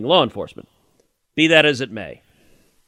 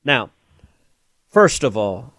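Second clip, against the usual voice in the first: normal talking voice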